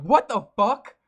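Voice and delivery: retelling a startled shout at the game; shocked outburst. Retold or imitated. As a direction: blurt it out in sudden shock. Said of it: "I'm like".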